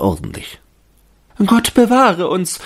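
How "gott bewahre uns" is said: high shrill cry of alarm; frightened shriek. A narrator's reading. Young ladies see a branch burning, shriek in alarm. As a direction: shriek it as the candle catches. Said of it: "schrien die Fräulein".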